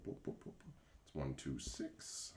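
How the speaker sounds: ordinary talk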